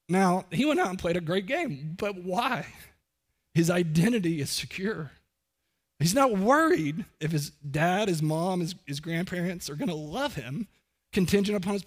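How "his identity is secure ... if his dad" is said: ordinary talk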